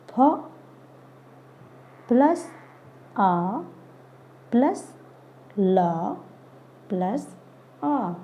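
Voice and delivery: फ, आ, ल, आ spoken as separate sounds with pauses, plus rising between them; sounding out letters. Shown as a demonstration. Teacher to children, sounding out फल sound by sound. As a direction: break फल into single sounds for a child. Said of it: "बोल के बताऊंगी".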